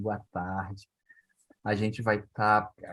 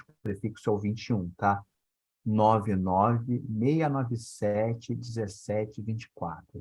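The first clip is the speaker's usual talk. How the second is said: slow and deliberate; slower than usual. Real, not acted. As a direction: spell out each number slowly, with pauses. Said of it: "Eu vou falar pausadamente".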